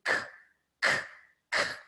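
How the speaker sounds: "k" as a bare consonant, no vowel; three separate short clicks of "k"; isolated K sound. Shown as a demonstration. Teacher showing the sound of C, bare "k" repeated. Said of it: "Você tem o som de".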